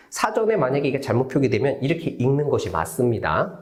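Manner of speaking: ordinary talk, nothing marked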